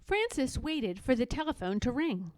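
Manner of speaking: usual speaking voice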